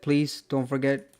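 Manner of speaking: normal talking voice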